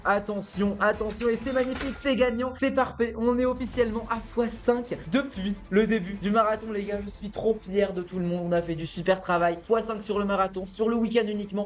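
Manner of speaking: real thrill as the marathon ends; excited celebration. Real, not acted. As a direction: cheer the big win to the viewers. Final winning trade on stream.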